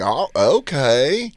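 very slow, drawn-out okay; a friend taking it in slowly; mock slow friend reaction. Nate hearing the news, very slow okay.

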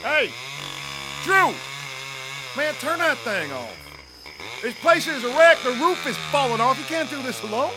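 high, strained calling out; shouting over noise. Acted. Man urging his brother to accept help, shouting. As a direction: yell to be heard over the machine.

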